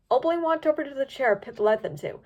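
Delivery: usual speaking voice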